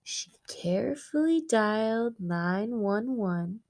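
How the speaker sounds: lively read-aloud for the class; story narration. A narrator's reading. Teacher reading a picture book to her students, animated storytelling.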